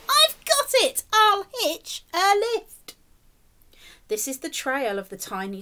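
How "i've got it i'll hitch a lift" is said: high, loud burst of delight, then slower; excited cry. Acted. Tiny snail crying out its plan, high and loud. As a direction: shout out the bright idea. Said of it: "then cried".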